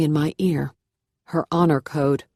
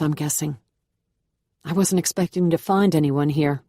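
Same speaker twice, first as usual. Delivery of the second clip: plain reading voice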